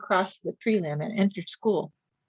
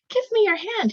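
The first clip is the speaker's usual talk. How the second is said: high, eager little raccoon; eager child; 14 semitones higher than usual. A narrator's reading. Grinning raccoon child asking his mother, high and eager. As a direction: ask brightly, much higher.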